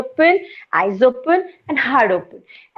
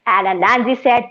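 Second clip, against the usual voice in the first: usual speaking voice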